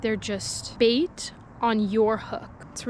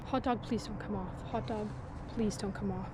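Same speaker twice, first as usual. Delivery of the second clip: hushed pleading to the bait; quiet begging whisper; whispered plea; 11 dB quieter than usual. Real, not acted. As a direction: beg it softly under the breath. Pleading with the hot dog.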